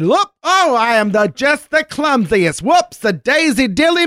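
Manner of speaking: hammed-up sugary apology; faux-clumsy gushing. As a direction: ham up fake clumsiness. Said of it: "and said".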